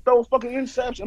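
like a fan screaming at a bad call; exasperated shout. Real, not acted. Ranting about the refs' calls, with an outraged high yell.

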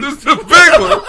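loud. Pretend. Echoing the deathbed joke.

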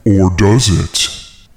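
slow, teasing tone; drawn-out knowing tease; sly. Acted. Jesus teases about the Jedi trick.

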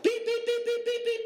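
high-pitched imitation of a hospital monitor's beep. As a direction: chirp out each beep like an alarm going off. Hospital monitor alarm going off.

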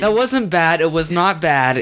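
high, emphatic defence; defensive insistence. Real, not acted. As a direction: insist it wasn't bad. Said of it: "Auto-tune".

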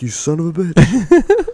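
high, slow mock outrage. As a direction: drag it out in fake fury. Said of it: "message you and be like".

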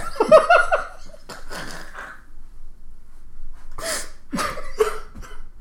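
long, high wheezing laugh; real breathless laughing fit; helpless laughter. Real, not acted. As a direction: crack up until the breath runs out. Cracks up at own crude line.

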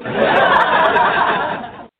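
big open laugh; loud burst of laughter. Real, not acted. Laughing at own discovery.

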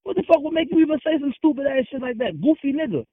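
heated, high-pitched outburst; real indignation. Real, not acted. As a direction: fire back in high-pitched outrage. Indignant retort to accusation of lying.

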